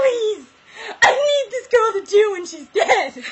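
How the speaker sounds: high, loud, laughing plea; mock begging. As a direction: beg desperately through laughs. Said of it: "He's like".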